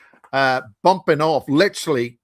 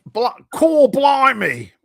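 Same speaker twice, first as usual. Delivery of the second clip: high, loud mock-British blimey; fake British accent; 11 semitones higher, 7 dB louder, much slower than usual. Northern Irish man mocks Americans with a fake British accent. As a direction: ham up a cockney blimey. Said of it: "a fake Brit-, British person".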